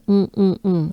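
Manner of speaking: "on" closed and nasal, no n; French nasal on. Shown as a demonstration. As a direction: isolate the nasal "on". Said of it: "it's that funny, very French sound".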